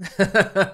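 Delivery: high, loud burst of laughter; sudden loud laugh. Real, not acted. Laughs at the song title.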